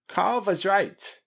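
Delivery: high, slow emphatic call; emphatic exclamation. A narrator's reading. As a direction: announce it loudly and drawn out. Character exclaims agreement to companions.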